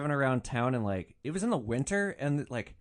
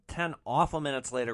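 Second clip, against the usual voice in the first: ordinary delivery, nothing marked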